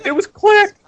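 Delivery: high-pitched silly reply; high squeaky voice. Retold or imitated. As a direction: squeak it out like a goofy guy. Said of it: "One says".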